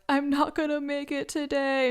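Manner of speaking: a high, strained, upset voice; shaken voice. Retold or imitated. Calling her therapist after the crash, high and shaken. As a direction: sound rattled and close to tears.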